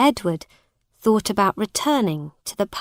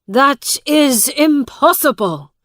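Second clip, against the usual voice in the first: raised, sharp protest; shouted; 6 semitones higher, 6 dB louder than usual. A narrator's reading. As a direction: shout it in disbelief. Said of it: "shouted Miles".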